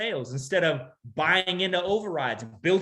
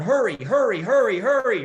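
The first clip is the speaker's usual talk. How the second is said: impatient mom; 6 dB louder than usual. Retold or imitated. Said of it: "And the mom's going".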